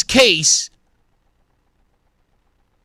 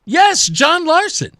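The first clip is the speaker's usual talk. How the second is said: higher, drawn-out yes with a rising end; emphatic confirmation; 7 semitones higher, slower than usual. Acted. Host answering stunned listeners, emphatically confirming. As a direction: confirm it slowly and pointedly. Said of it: "And I'm going".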